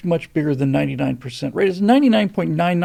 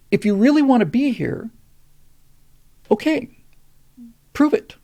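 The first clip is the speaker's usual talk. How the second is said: challenging; 7 semitones higher than usual. Real, not acted. Challenge put to immigrants.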